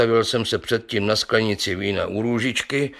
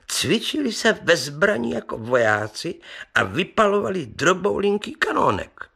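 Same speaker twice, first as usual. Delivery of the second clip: higher, animated storyteller; lightly voiced marvelling merchant; 11 semitones higher than usual. Merchant tells the gentlemen of a flea theatre, mildly marvelling. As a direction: marvel at it in a raised, lively voice.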